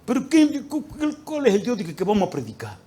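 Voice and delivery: retold desperate shout at heaven; angry outburst. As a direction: rail at God in frustration. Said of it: "empecé a pelear".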